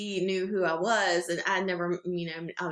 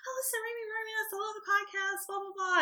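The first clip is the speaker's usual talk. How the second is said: much higher, softer, fast gush; mock gushing fans; 13 semitones higher, 4 dB quieter than usual. Imitating fans gushing in line, lightly mocking, high. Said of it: "they were like".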